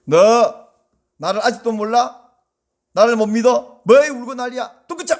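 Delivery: loud mock scolding of a crying woman. A harsh Jesus scolding weeping Mary, mock anger. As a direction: scold her for crying, half-hearted. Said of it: "이러지도 않으셨고".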